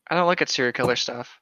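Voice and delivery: usual speaking voice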